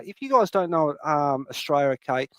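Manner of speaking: ordinary talk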